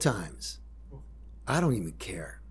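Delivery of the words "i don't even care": whispered, lower, giving up; defeated whisper. Real, not acted. Arrested father whispering to himself that he doesn't care. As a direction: let it drop to a whisper.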